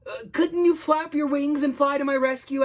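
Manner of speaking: high, urgent plea; pleading. Acted. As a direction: coax desperately for a rescue. Stranded boy pleads for rescue.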